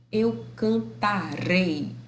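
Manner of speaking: "cantarei" drawn out, REI stressed; stress on final syllable. Shown as a demonstration. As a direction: slowly land on REI in "cantarei". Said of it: "esse rá e esse ré vai ser tônico".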